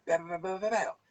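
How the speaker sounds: quick bow bow bow sound effect; mock money noise. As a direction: rattle off coins piling up. Imitated money piling up.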